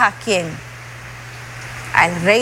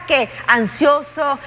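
ordinary talk, nothing marked

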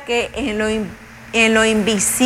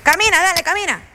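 high-pitched, quick mock command; mock urging; 6 semitones higher, faster than usual. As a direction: urge a baby along in pretend impatience. Mock order to a baby.

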